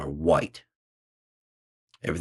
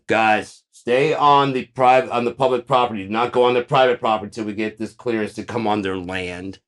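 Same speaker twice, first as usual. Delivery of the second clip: high, loud barked orders to the squad; agent commanding team; 5 semitones higher, 7 dB louder than usual. Acted. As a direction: bark orders at the crew.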